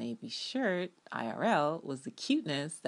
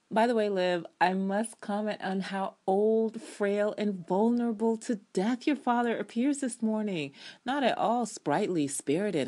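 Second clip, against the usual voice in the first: sarcastic mock concern; 7 semitones higher, 4 dB louder than usual. Recapper teasing Liv about her frail father, sarcastic.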